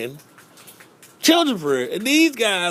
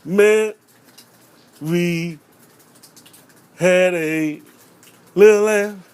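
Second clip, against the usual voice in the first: put-on stumbling kid recital; 4 dB louder, much slower than usual. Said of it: "talking about".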